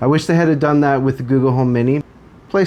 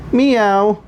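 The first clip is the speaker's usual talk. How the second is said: high cat meow; 8 semitones higher, 3 dB louder than usual. Pretend. Meowing at smart speaker.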